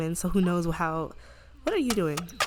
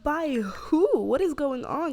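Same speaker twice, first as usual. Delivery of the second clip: high, loud mock astonishment; acted scandalized friend; shocked demand; 7 semitones higher, 4 dB louder, much slower than usual. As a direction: stretch out the stunned questions. Acted friend demanding to know the father.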